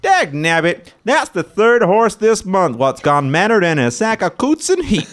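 put-on high, loud old-timer yokel; old prospector. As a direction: holler like a crusty old prospector. Sheriff character complaining.

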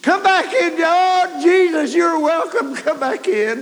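high, loud fervent welcome; ecstatic cry. Retold or imitated. Dying man cries out welcoming Jesus back, high and loud. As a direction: call Jesus back in with full heart.

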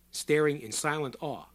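plain reading voice

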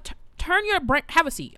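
high, loud mock scolding; worked-up retold telling-off; exasperated teacher. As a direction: scold a pupil at the end of your patience.

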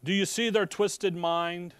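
emphatic prosecutor addressing the court. Acted. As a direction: press the case hard, drawing out the key words. Acting prosecutor addressing the judge.

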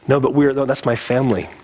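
ordinary delivery

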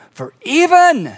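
high, drawn-out proclamation; emphatic proclamation. Acted. Jesus finishing his teaching to the twelve, proclaiming. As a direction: launch the verse high and stretch it. Preacher proclaims the climactic verse.

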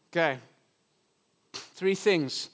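ordinary talk, nothing marked

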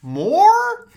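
high, loud shouted question; loud dad shout. Retold or imitated. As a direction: bellow it at the TV. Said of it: "super loud".

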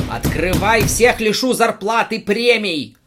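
very high, loud bellow. Acted. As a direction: bellow through the gates. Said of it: "орал Игорь Викторович". (ор во всё горло)

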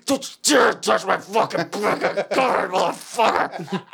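strained comic yelling match; hammed-up sputtering fury; mock drill-sergeant rage. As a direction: act out a furious wake-up outburst. Acts out army wake-up rage.

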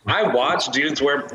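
ordinary delivery

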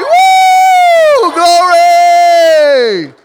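very high, loud, long-held cheer; real full-voiced praise whoop; jubilant shout. Real, not acted. Preacher leading the church's big shout for Jesus, jubilant shout.

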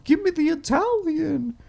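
very high timid squeak; intimidated customer. Retold or imitated. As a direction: squeak the order out, mad intimidated. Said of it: "so you're just like".

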